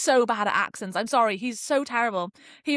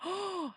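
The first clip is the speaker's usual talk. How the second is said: re-enacted sharp gasp, much softer; shocked gasp; 8 semitones higher, 11 dB quieter than usual. Vlogger replaying her shock at the death, gasping. Acting out shocked real-time reaction.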